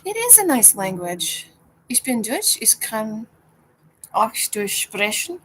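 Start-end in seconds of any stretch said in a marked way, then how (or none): 1.9-5.4 careful German phrases, a bit slow; speaking German. Shown as a demonstration. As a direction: show off a little German. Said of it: "speaks German".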